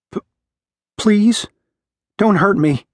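high, stammering plea for mercy; frightened pleading. A narrator's reading. Woman pinned by attacker begs not to be hurt, frightened. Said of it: "she pleaded".

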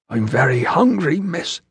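words mangled by a swollen jaw. A narrator's reading. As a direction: struggle to form words through an injured jaw. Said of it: "he heard himself mangle the words".

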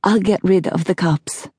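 plain reading voice

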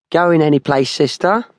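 nasal, high-pitched whine in fake American; wheedling mock-American youth; phony American accent. A narrator's reading. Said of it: "spoke with a nasal whine, aping an American accent".

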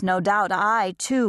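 usual speaking voice

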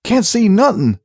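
high, uneasy thief voice; small and high, unsettled by the dark. A narrator's reading. Thief blinded by sudden darkness, small worried voice. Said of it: "his voice small".

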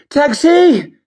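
high, loud hailing cry; yell. A narrator's reading. Drunk woman hailing a cab, yelling. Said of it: "she yelled".

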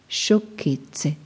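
slow, understated verbal tic; quoted catchword. A narrator's reading. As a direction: stretch the word out as a habitual brush-off. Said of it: "Era il suo modo di intercalare".

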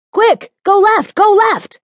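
high, loud urgent yell. A narrator's reading. Passenger yelling at the driver to turn. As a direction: shout the order to the driver. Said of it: "He yells".

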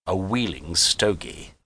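ordinary delivery, nothing marked

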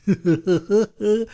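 high manic killer's cackle; manic laugh. A narrator's reading. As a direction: cackle like a madman. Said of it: "Johnny laughed manically".

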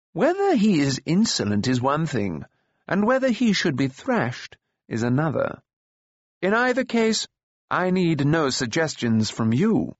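put-on high-pitched old man, measured and dismissive; unperturbed old enchanter. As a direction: put an upstart in his place.

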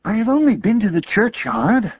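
put-on high tearful child's plea; a small boy sobbing out an excuse; crying. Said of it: "crying and rubbing myself".

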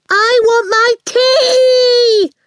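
put-on high, drawn-out child's bellow; piercing yell. A narrator's reading. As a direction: yell it slow and piercing, stretching the last word. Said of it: "Awful yelled, in the piercing way".

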